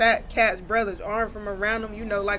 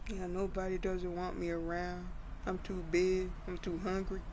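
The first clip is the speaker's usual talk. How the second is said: mild mopey sadness; 2 semitones lower, 10 dB quieter than usual. Acted. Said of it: "'cause he was sad".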